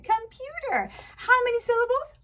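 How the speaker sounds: "Computer" as one word, pitched high; upbeat teacher quiz. Pretend. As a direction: quiz a small pupil excitedly on "Computer". Quizzing pupil on the whole word.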